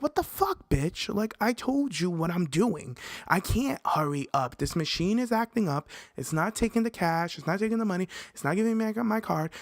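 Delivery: stuck at the card machine, shouting back at friends, high exasperated rant. Retold or imitated. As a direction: vent in a high-pitched, put-upon huff. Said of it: "I'm like".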